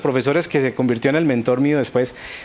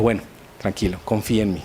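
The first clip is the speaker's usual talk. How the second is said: calm reassurance; 5 semitones lower, 5 dB quieter, faster than usual. Retold or imitated. Mentor calming the crying student.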